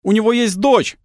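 высокий напряжённый выкрик; выкрикни резко и очень высоко; крик из толпы. A narrator's reading. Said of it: "раздался крик". (крик из толпы)

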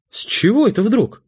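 high-pitched wifely protest; indignant wife, voice pitched right up. A narrator's reading. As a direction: object sharply to being left out. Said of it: "возмутилась Светлана".

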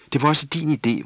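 high, sharp reproach; snapping. Acted. Tulle blaming Jule for the bath toy, irritated snap. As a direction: snap the blame at her. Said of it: "vridsede Tulle".

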